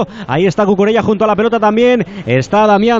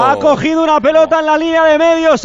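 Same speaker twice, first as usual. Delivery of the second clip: higher, slow, excited goal recap; excited commentary; 6 semitones higher, slower than usual. Real, not acted. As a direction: relive the goal, still buzzing. Goal replay description.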